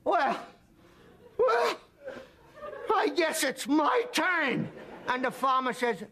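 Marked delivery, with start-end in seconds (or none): 0.0-4.7 very high, strained kick-victim voice; comically pained voice. Acted. Kicked guy to the farmer, high mock-pained voice. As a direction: squeeze it out high and slowly, hammed-up agony.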